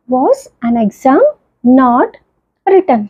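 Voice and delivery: very slow, broken into chunks; slow classroom reading, words spaced apart; slow dictation. Shown as a demonstration. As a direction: build the sentence piece by piece. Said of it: "teacher building passive sentence".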